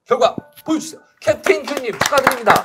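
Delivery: high, loud winner reveal; hyped giveaway announcement. Real, not acted. Revealing second place winner. (신나는 경품 발표)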